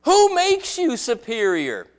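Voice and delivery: high, loud disbelief, slowing on the question; Paul scoffing at their pride; mock-outraged apostle; incredulous Paul. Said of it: "And Paul goes".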